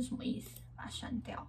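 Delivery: soft whisper to herself. Real, not acted. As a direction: whisper it under your breath. Muttering while editing.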